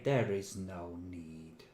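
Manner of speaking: ordinary talk, nothing marked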